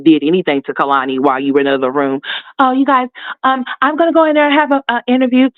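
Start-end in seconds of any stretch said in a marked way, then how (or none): 2.6-5.5 higher, faster mock-sweet voice; mocking Olivia. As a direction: sneer while playing the sweet act. Mocking Olivia addressing viewers.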